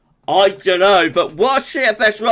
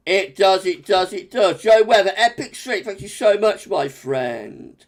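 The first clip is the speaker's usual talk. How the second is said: normal talking voice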